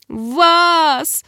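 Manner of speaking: high, pained squeal. A narrator's reading. Girl in burning pain calls the creature, squealing. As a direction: cry the name out high and drawn. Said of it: "взвизгнула я".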